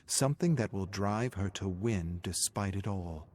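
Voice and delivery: plain reading voice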